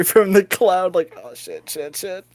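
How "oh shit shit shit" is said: very soft panicked mutter; hushed muttering. Acted. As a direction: swear under your breath while slipping away. Character sneaks away through crowd.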